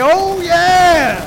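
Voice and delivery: big, loud, high "Oh, yeah!" bursting through the wall; Kool-Aid Man. Retold or imitated. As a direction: shout it like a mascot crashing in. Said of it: "Let's bring up Kool-Aid Man".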